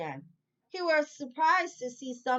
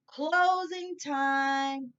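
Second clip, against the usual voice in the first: closing call; 5 semitones higher, 3 dB louder, much slower than usual. Acted. Manager calls out closing.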